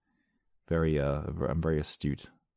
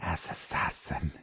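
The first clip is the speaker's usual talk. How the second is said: whisper; 8 dB quieter, much slower than usual. Pretend. Spooky reading of a headline.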